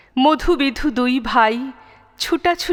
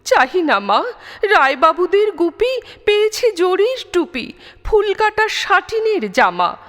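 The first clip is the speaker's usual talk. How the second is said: higher, insistent child's complaint, lightly acted; a touch of tearful refusal in a raised pitch; tearful protest; 6 semitones higher than usual. A narrator's reading. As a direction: read as a mildly upset boy's plea. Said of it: "কাঁদিয়া কহিল".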